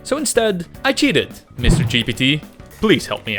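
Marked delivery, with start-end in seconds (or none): none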